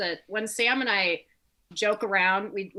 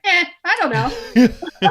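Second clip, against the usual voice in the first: shrugging dismissal; 9 semitones higher, 10 dB louder, slower than usual. Retold or imitated. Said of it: "and I was like".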